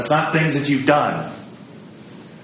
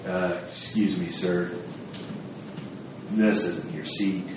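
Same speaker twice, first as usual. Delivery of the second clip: much softer, hushed and discreet; polite correction; 8 semitones lower, 10 dB quieter than usual. Retold or imitated. Host discreetly telling a guest he took the wrong seat, hushed. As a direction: quietly move someone from the wrong seat. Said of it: "somebody else come up and say".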